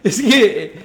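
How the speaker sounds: long loud burst of laughter, still laughing into the words; real laughter. Real, not acted. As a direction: crack up for real and try to keep talking. (carcajada real, riendo al hablar)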